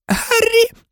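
very high, tearful squeak; gasped name. A narrator's reading. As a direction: gasp the name in a tiny, crying voice. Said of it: "видихнув Петтігру". (тоненький плаксивий видих)

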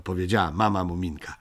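usual speaking voice

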